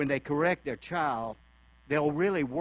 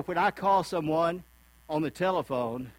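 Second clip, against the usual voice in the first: ordinary talk, nothing marked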